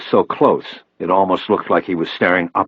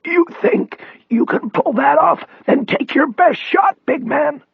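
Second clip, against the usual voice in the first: high, strained defiant rasp; strong rasp. A narrator's reading. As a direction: come back at him strongly through the rasp. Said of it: "but then came back strong".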